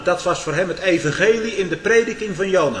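rising to a very high, loud, slowed cry; building preaching climax. Acted. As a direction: climb and hammer veertig dagen. (opbouwend naar een luide climax)

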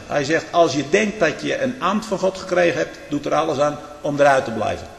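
normal talking voice

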